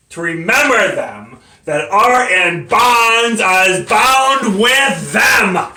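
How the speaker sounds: loud, high, drawn-out scripture quote; fervent pulpit-style declaiming; preacher's fervour. Acted. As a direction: swell to a ringing cry, stretching the last words. Defendant quotes scripture to the court.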